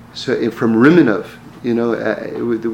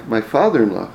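normal talking voice

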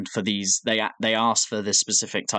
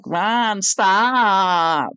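high, drawn-out wail of "stop"; mock screaming; 9 semitones higher, 4 dB louder than usual. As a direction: imitate a shrill, stretched-out scream. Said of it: "He's fucking screaming".

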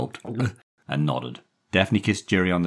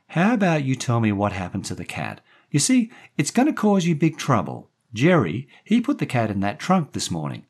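high, louder woman's voice; coaxing woman; 10 semitones higher, 6 dB louder than usual. A narrator's reading. Daphne questioning the boy about the cat, coaxing. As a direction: press him gently for an answer.

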